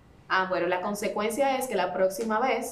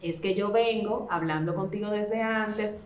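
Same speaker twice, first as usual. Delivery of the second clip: usual speaking voice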